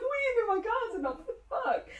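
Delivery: high, laughing what the fuck; outraged but giggling; laughing mock outrage. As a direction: retell the shock through laughter. Matt's shocked reaction retold.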